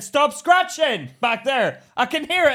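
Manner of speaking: loud, high yell toward the back seat; exasperated shouting at a child; retold scolding shout. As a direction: bellow a drawn-out order over your shoulder. Retells shouting at baby in car.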